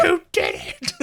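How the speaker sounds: high, loud mock scream; retold scream. Mabel cheering on her friend, high mock scream. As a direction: shriek it as an excited retelling.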